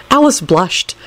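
usual speaking voice

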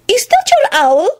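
very high, drawn-out worried question; slow, high-pitched worry; worried interruption. A narrator's reading. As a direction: break in, alarmed. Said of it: "broke in worriedly".

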